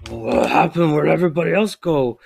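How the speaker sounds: loud, rising owlbear holler; alarmed owlbear. Acted. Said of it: "looks down and he's like".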